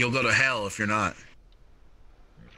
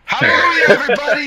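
preacher shout; 9 dB louder, faster than usual. Acted. Mock preacher celebration.

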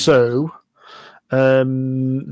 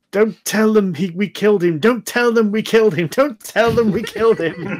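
shouting out; 6 semitones higher, 3 dB louder than usual. Acted. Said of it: "you hear the voice kind of shouting out".

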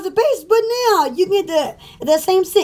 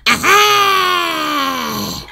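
triumphant aha. Real, not acted. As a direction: shout it in triumph. Triumphant exclamation.

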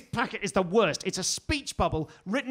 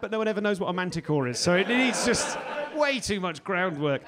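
usual speaking voice

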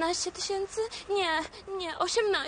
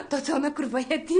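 laughing disbelief; faster than usual. Acted. Laughs at wife's shoe size.

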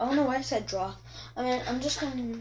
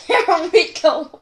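laughing; 13 semitones higher, 13 dB louder, slower than usual. Real, not acted. Laughs at her drawing.

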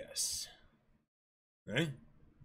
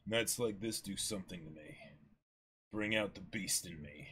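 a touch lower, softer sinister murmur; menacing Kuro; 5 semitones lower, 5 dB quieter than usual. Acted. Kuro to himself, a soft and slightly lower sinister murmur. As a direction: let the threat creep in. Reading Kuro's menacing line.

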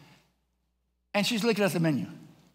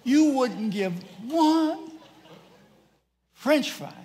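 slow, high, drawn-out mock outrage; indignant scolding; 9 semitones higher, much slower than usual. Dad reminds son of the fry, indignant. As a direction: stretch it out, aggrieved.

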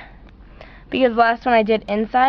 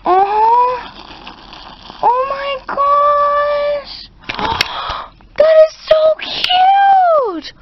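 very high, loud, slow stretched squeal; delighted squeal; 3 dB louder, much slower than usual. Real, not acted. Squealing at the cute Chow Chow for the viewers. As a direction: melt into a high gushing squeal.

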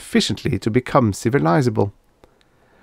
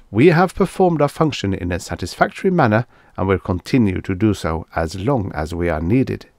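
usual speaking voice

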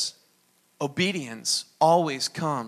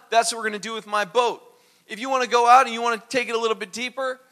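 eager obedience; 5 semitones higher, 5 dB louder than usual. Retold or imitated. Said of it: "He said".

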